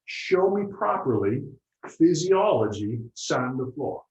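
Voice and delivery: lightly voiced Mister Miyagi, slow and firm. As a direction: spell out each word like a patient mentor. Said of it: "Here's what he did".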